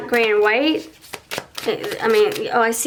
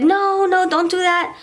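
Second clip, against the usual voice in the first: pleading partner; 8 semitones higher than usual. Retold or imitated. Partner pleads not to pull away.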